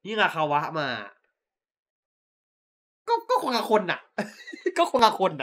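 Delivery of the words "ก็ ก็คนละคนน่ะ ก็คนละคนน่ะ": high, amused objection; laughing protest. Real, not acted. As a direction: protest through a burst of laughter. Laughing protest to co-host's comparison. (หัวเราะแย้งเสียงสูง)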